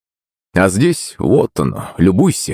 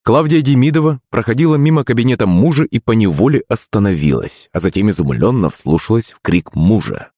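usual speaking voice

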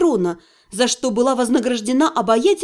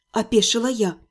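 ordinary delivery, nothing marked

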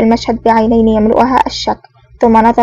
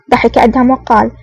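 usual speaking voice

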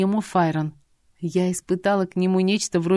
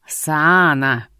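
louder growled dragon call; drake; 2 semitones lower, 5 dB louder than usual. A narrator's reading. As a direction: snarl the name like a beast. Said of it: "прорычал золотой дракон".

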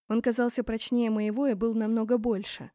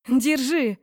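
loud, raised-pitch command; crying out; 4 semitones higher, 8 dB louder than usual. A narrator's reading. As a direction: shout an order under strain. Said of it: "выкрикнула". (громкий выкрик)

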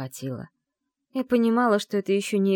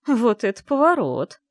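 louder, higher, wryly amused exclamation; amused surprise; 5 semitones higher, 6 dB louder than usual. A narrator's reading. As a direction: react to the twist with an amused smirk. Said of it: "усмехнулась женщина". (с удивлённой усмешкой)

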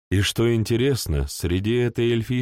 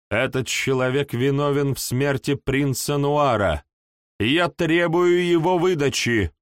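indignant demand; 6 semitones higher than usual. A narrator's reading. Elf matron demands his surrender.

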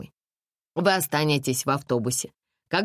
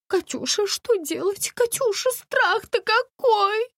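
much higher, piercing shriek of a panicky lady; shrill lady's cry; slower than usual. A narrator's reading. Panicky lady cries out in the dark bus. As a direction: screech it like a hysterical lady. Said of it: "опять закричала дамочка".

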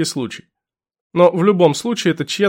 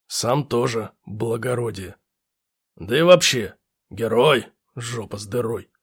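gruff lieutenant; 5 semitones lower, 4 dB quieter than usual. Acted. Lieutenant voiced.

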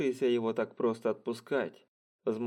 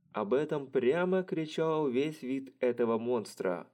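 usual speaking voice

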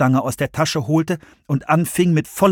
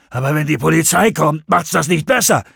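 higher, tense objection; worried Russ; 4 semitones higher, 3 dB louder than usual. A narrator's reading. Russ warns George the police will come, worried. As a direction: warn him, nervous and urgent.

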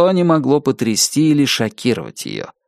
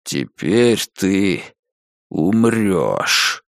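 slow, lower menacing threat; evil rumbling voice; 5 semitones lower, much slower than usual. A narrator's reading. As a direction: growl the death threat. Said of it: "пророкотал злобный голос".

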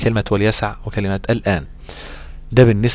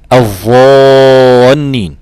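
slow, drawn-out chanted recitation, louder; Quran recitation demo; 9 dB louder, much slower than usual. Shown as a demonstration. Teacher reciting an example word for students, slow and chanted. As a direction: stretch the word as in tajweed recitation.

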